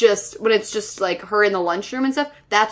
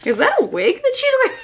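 much higher, fast incredulous squeak; mock shocked question; 13 semitones higher, faster than usual. Asking about the wig, much higher and fast. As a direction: squeak it out in disbelief. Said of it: "but I go".

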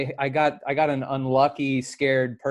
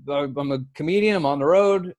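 ordinary talk, nothing marked

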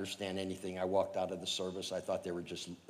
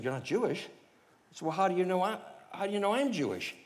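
higher, bewildered questions; puzzled questioning; 10 semitones higher than usual. Retold or imitated. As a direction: press him, baffled.

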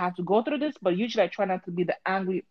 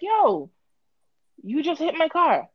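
high exclaim; 9 semitones higher, slower than usual. Retold or imitated. Telling off the lady.

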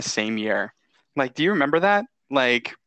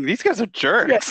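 higher, slow put-on voice; Chris Evans voice; 9 semitones higher, slower than usual. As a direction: grumble it as the actor.

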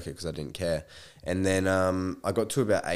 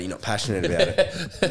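laughing mock boss; 12 semitones higher, 5 dB louder than usual. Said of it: "And they were like".

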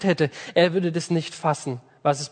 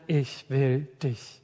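soft and very slow, lower; tender promise; 5 semitones lower, 6 dB quieter, much slower than usual. Acted. Preacher gives God's promise to his people, soft and slow. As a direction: say it gently, slowly, with devotion.

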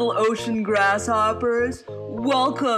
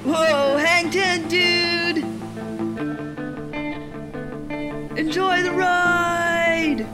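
higher surfer dude cheer; excited surfer shout; 9 semitones higher, much slower than usual. Acted. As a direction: call it out over the waves. Surf skit cheer.

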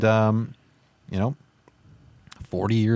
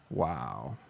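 stunned; much slower than usual. Real, not acted. Stunned after lucky pull.

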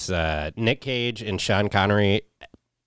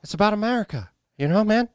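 mock politician; 10 semitones higher than usual.

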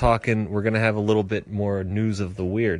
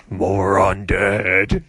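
spooky drawn-out voice; 3 semitones lower, 4 dB louder, much slower than usual. Acted. Spooky tease of undead.

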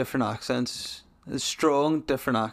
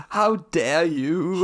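slow, high, hammed-up indignation; mock outrage; 5 semitones higher, 4 dB louder, much slower than usual. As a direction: draw it out, loftily scandalised in jest.

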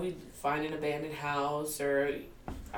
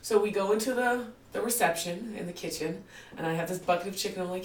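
usual speaking voice

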